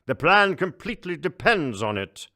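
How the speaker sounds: a booming, self-important ruler; pompous emperor. Acted.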